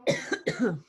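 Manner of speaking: dry cough from a tickling throat; choking cough. Real, not acted. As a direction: cough hard while choking. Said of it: "I'm choking and I don't have water here".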